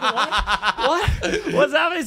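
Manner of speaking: high-pitched startled questioning; mock bewildered. Comic retelling his colleague's reaction on the way on stage, bewildered. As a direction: imitate someone caught off guard. Acting out Bobby's startled reply.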